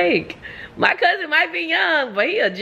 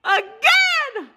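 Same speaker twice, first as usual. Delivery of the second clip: dramatic proclamation; 9 semitones higher than usual. Acted. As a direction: read it like a doom-laden herald. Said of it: "it says".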